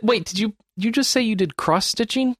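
incredulous, pitch jumping up high; stunned disbelief, voice shooting up; double take. Real, not acted. Friend admits cross stitching, not games.

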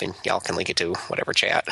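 usual speaking voice

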